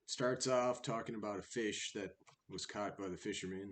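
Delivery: ordinary delivery, nothing marked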